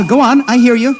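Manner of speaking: loud, urgent reply; urgent calling. Acted. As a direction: keep the contact alive. Shouting into the radio.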